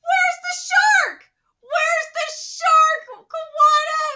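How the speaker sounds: high, loud and slow wail; outraged shout. Real, not acted. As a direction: cry it out in protest. Complaining to the author.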